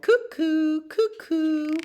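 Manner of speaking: high, loud sing-song cuckoo call. A narrator's reading. Reading the cuckoo to a child, high cuckoo call.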